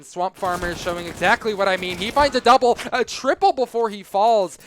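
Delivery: rising hype call, loud and high; excited. Real, not acted. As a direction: shout the multi-kill as it happens. Player gets double, triple kill.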